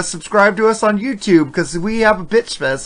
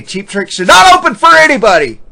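emphatic declaration; 7 semitones higher, 6 dB louder than usual. Retold or imitated. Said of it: "And I always say".

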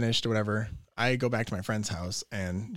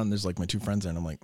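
ordinary talk

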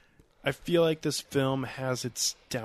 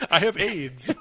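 laughing; 6 semitones higher, 5 dB louder than usual. Pretend. Joking confession in laughter.

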